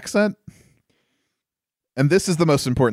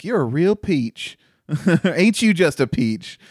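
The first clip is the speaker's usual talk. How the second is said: light Southern American drawl, slow; lightly imitating a Southern belle; mock Southern accent; 4 semitones higher than usual. Podcaster quoting a character's Southern line, light mock Southern accent. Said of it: "U S Southern American accent".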